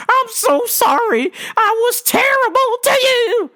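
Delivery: hammed-up tearful lady voice; mock crying customer. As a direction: wail it like a guilty customer.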